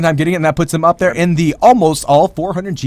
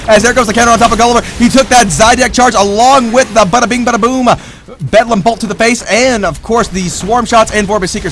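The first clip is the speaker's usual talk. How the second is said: higher, energetic esports commentary; hyped shoutcaster calling a fight; excited play-by-play; 7 semitones higher, 5 dB louder than usual. Real, not acted. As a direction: race through the action, then settle.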